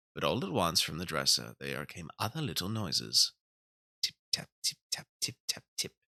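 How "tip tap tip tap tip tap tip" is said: soft, breathy tip-tap like tiny feet; whispered tapping. A narrator's reading. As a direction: whisper the tapping like little mice. Tapping sounds in the story.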